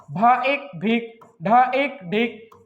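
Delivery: ordinary talk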